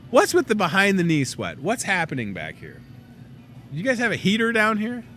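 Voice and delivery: ordinary talk, nothing marked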